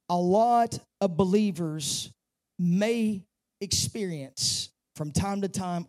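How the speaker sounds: ordinary talk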